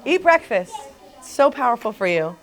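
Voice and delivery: emphatic advice. Retold or imitated. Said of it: "I'm like".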